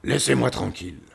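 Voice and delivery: angry lion dismissal; roaring lion. A narrator's reading. Lion sends the visitors away, roaring. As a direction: roar to be left alone. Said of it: "rugit-il encore plus fort".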